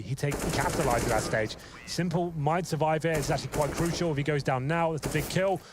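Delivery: usual speaking voice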